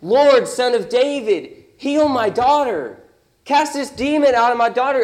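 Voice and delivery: loud, high, desperate cry for help; screaming plea. Acted. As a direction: cry it out at the top of your lungs. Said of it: "screaming at the top of her lungs".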